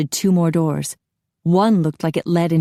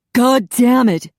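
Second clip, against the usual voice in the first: loud, slow furious roar; 7 semitones higher, 6 dB louder, slower than usual. A narrator's reading. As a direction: bellow in rage. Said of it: "Charlie roared".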